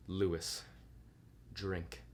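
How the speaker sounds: a low, curt command with an edge of impatience; firm, a little impatient. Acted. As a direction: cut him short, no room for argument. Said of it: "said firmly, a little impatiently".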